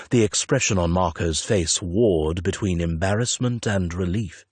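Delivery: ordinary delivery, nothing marked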